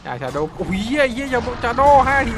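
high, loud, slow shocked outburst; excited yell. Real, not acted. Caster yelling as Shadow gets killed, shocked and loud. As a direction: blurt it out as the kill lands. Caster yells at sudden kill.

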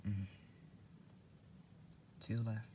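normal talking voice

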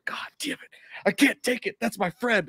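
hammed-up distress; mock anguish. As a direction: choke it out watching your friend suffer. Friend watching partner tortured.

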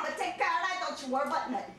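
ordinary talk

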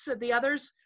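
usual speaking voice

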